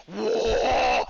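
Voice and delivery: loud, high mock yell; imitated yell. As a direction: yell it like an angry movie cop, imitating. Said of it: "He's yelling at him".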